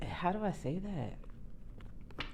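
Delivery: slow, soft whisper to oneself; whispered aside. Real, not acted. Reader working out how to phrase it, whispering. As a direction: whisper it while searching for words. Reader searching for words aloud.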